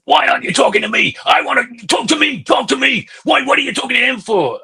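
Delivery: high, rapid mock badgering. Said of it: "he's going".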